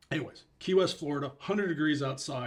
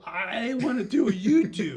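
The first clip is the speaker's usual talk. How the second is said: slow, higher, laughing plea; eager, laughing; 8 semitones higher, 6 dB louder than usual. Retold or imitated. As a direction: say it earnestly through laughter. Eager recruit at door.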